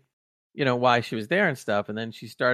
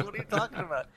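higher, softer mock bewilderment; alarmed patient; 7 semitones higher, 8 dB quieter, faster than usual. Patient wakes up and questions the doctor, mock alarmed. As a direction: imitate a patient's baffled protest.

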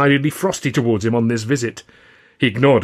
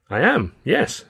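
mildly surprised, voice lifted higher; 6 semitones higher, slower than usual. A narrator's reading. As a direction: answer as if caught off guard. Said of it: "I said, surprised".

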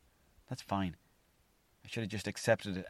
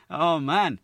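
gloating laugh; 6 semitones higher, 10 dB louder than usual. Retold or imitated. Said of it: "they're laughing at it".

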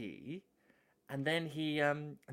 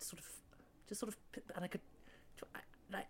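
1 whisper; 5 semitones higher, 15 dB quieter, much faster than usual. Real, not acted. Trailing hushed.

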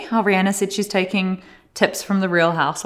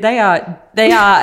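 louder repeated phrase, pressing on; emphatic quote; 2 semitones higher, 7 dB louder, slower than usual. Retold or imitated. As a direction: read it out with building emphasis. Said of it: "So it said".

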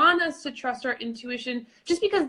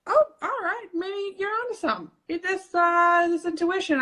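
high-pitched tentative dawning realization; mind coming round; 6 semitones higher than usual. Acted. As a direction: lift it high, like a mind being won over. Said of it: "our minds can start to go".

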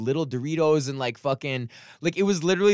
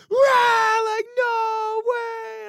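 very high, strained mock roar; imitated crowd outcry; 4 dB louder, much slower than usual. Imitating the crowd learning of private school, outraged outcry. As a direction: wail in outrage like a whole crowd.

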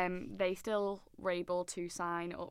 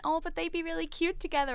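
high-pitched mock gush; gushing imitation; 9 semitones higher than usual. Podcaster quoting Nicole matching up the band, high gushing imitation. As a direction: coo over them in a raised, imitated voice.

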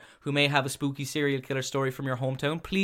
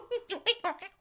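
clown laugh; 3 dB quieter than usual. Acted. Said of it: "That was my clown impression".

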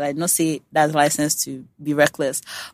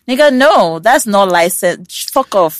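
drawn-out, emphatic refusal, pitched up; mock indignant rebuke; 4 semitones higher, 6 dB louder, slower than usual. Woman rebuffing men excited about the pill, mock indignant. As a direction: shut them down with playful exasperation.

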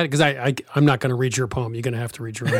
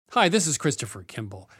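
ordinary talk, nothing marked

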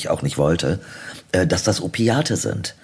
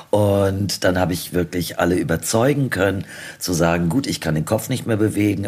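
normal talking voice

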